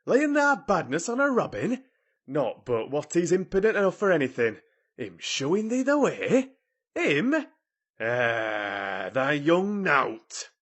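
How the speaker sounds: loud, high Yorkshire scolding; outraged. A narrator's reading. Ben defending the robin against Mary, outraged. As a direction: splutter with indignant disbelief. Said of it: "He was so outraged".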